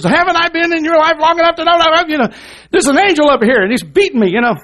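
indignant donkey in a high, loud squawk; talking donkey. Acted. Balaam's donkey turns round to protest, high loud indignant voice. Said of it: "the donkey turns around and says".

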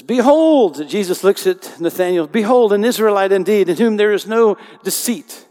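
loud, high proclaiming voice of Jesus; preaching at full force. Acted. Preacher booming Jesus' words to the congregation, loud and high. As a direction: lift the voice and proclaim it.

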